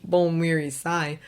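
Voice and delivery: usual speaking voice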